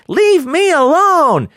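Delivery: high, slow mock whine; kid screaming at sibling. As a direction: stretch it out like a squabbling kid. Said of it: "instead of screaming out things like".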